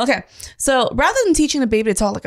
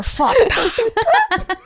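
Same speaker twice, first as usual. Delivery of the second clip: put-on baby noises; 11 semitones higher, much slower than usual.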